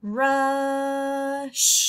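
loud drawn-out "Rush"; "Rush" modelled for the class; blended word. Shown as a demonstration. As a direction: stretch "Rush" slowly. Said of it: "Do that one more time".

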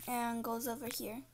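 ordinary talk

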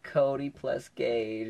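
slow, drawn-out reveal; proud announcement. Retold or imitated. Student reading his board message, slow and proud. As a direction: spell out the names with relish. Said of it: "I'm like".

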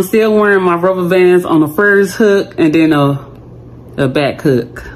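talking with finger in mouth; lip pulled down by finger. Real, not acted. Seen 0: Finger holds lower lip down showing braces throughout the labelled words.

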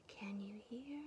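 tiny, barely audible whisper. A narrator's reading. Mouse from bottom of his bed to Bear, whispering. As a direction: whisper it to test their hearing. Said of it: "and whispered".

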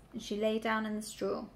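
ordinary delivery

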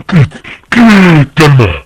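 high, loud, drawn-out warning; panicky shout. Real, not acted. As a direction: shout to keep them away. Warding off game enemy.

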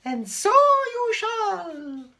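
very high, loud fairy voice; high magical godmother. A narrator's reading. The hairy godmother appears, very high and loud.

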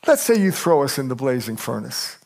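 ordinary talk, nothing marked

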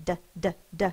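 "D, d, d" tapped loud like a drum. Shown as a demonstration. Teacher showing children how the d sound taps like a drum. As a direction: tap out the d sound repeatedly. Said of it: "tapping behind my teeth like a drum".

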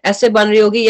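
usual speaking voice

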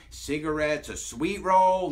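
normal talking voice

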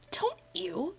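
high mock scolding. Real, not acted.

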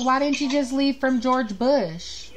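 usual speaking voice

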